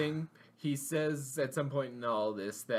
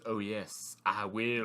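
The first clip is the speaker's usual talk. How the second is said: low, understated sinister reply; lowered, mock-menacing agreement; ominous dad; 5 semitones lower, much slower than usual. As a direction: lightly voice a creepy father, dropping the pitch. Voices ominous dad's reply.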